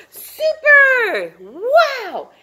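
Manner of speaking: high loud super; cheer. Real, not acted. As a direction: cheer the class.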